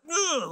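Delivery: high-pitched imitated groan; squirming groan. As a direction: groan like a friend avoiding attention. Imitates friend squirming, looking down.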